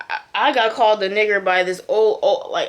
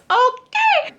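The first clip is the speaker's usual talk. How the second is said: gleeful okay; 3 dB louder than usual. Real, not acted. Reacting to the crash.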